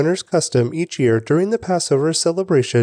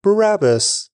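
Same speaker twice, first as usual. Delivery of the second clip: mild narrated shout; 7 semitones higher than usual. A narrator's reading. Crowd answers the governor's question, mild narrated shout. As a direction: call the name back like a crowd, restrained. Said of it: "The crowd shouted back".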